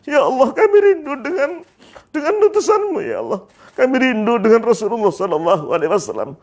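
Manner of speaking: high, wailing plea; crying harder. Acted. Bilal calling to Allah in longing, crying harder. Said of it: "tambah nangis lagi".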